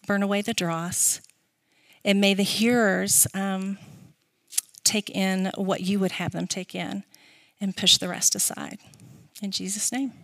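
soft, hushed prayer voice. Acted. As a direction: pray it quietly, fading down. Leads an opening prayer.

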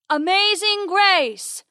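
high, long-drawn distant holler; bawled taunt. A narrator's reading. Poacher outside calling the ranger out, bawling. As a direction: bawl it slowly, stretching the vowels high. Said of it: "bawls a voice from outside".

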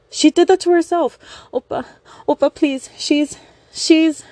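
high, loud, stammering panic; frantic begging, near tears; hysterical high plea. Acted. Young woman begging hysterically.

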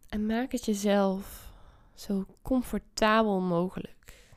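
guided meditation. Acted. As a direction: ease them into calm. Leads a meditation.

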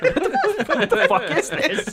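high, laughing what-the-hell; laughing disbelief. Retold or imitated. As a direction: squeal it out between laughs in mock shock. Friends mock their reaction to a scene.

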